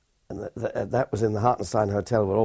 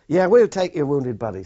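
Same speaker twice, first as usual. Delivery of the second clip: raised voice; 6 semitones higher, 9 dB louder than usual. Retold or imitated. Canadian boatmen over gunfire and rain.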